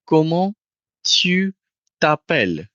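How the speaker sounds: Comment, tu, t'appelles broken into separate words. Shown as a demonstration. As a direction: spell it out word by word for the learner. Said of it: "you say".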